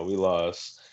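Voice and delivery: ordinary delivery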